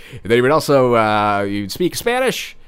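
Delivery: usual speaking voice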